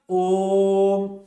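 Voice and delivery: ओम drawn out to three times a short vowel; prolonged Om. Shown as a demonstration. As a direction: stretch ओम long and even, like a chant. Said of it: "ये ओम का उच्चारण कर रहा है".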